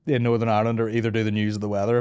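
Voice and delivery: ordinary talk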